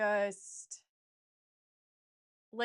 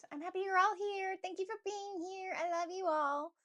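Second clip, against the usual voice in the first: sweet thanks; 8 semitones higher than usual. Real, not acted. Thanking viewers.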